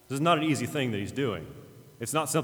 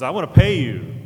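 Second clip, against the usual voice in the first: loud, raised burst of gratitude; eager offer; 5 semitones higher, 14 dB louder than usual. Retold or imitated. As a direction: call it out loudly, imitating an eager grateful man. Healed man eagerly offers payment.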